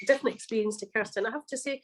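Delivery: ordinary talk, nothing marked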